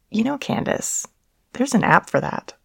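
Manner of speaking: coy. Acted. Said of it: "Gloria had coyly suggested".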